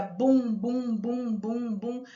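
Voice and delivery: steady row of booms, fading; rhythmic comic-book thumps; imitated drumbeat. Shown as a demonstration. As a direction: beat out the drum with the voice. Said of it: "além da onomatopeia".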